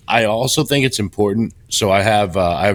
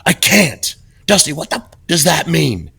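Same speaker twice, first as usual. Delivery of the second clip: higher, disbelieving mock outburst from the coach; incredulous coach; 7 semitones higher than usual. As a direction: retell the coach's stunned outrage, pitched up. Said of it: "He goes, he gets up and he says".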